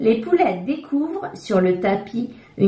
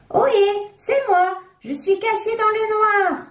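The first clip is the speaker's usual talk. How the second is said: much higher playful little voice; hidden voice calling; 12 semitones higher than usual. A narrator's reading. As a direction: call out from the dark, teasing. Voice hidden in the dark.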